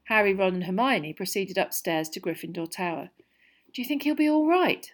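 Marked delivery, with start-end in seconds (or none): 3.7-4.9 higher-pitched, anxious question; worried girl asking, pitch lifted; nervous. A narrator's reading. Hermione asks friends about injured classmate, nervous. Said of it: "said Hermione nervously".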